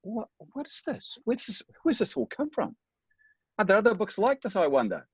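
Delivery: high-pitched astonished questions; as if the book just blew your mind; amazed wonder. Retold or imitated. Reader discovering a self-help book for the first time, high amazed wonder.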